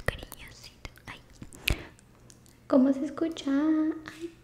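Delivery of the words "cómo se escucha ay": high squeaky helium voice; squirrel voice. Real, not acted. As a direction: chipmunk voice from the balloon. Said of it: "me hace voz de ardillita".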